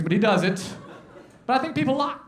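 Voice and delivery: usual speaking voice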